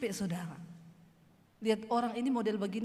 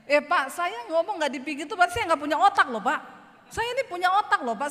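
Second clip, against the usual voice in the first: louder, higher laughing comeback; laughing retort; 6 semitones higher, 6 dB louder than usual. Retold or imitated. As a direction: tease him back through laughter. Preacher retorts to businessman, laughing. (membalas sambil tertawa)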